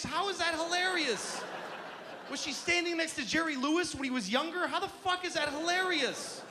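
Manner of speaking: high-pitched exasperated disbelief; incredulous comic fury, held high; outraged rant. Acted. Comic outrage at the misused word.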